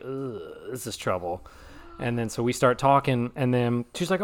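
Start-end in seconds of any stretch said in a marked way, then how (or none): 0.0-1.4 muttered dread. Retold or imitated. Retold dread on meeting ex.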